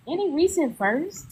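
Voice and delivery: ordinary talk, nothing marked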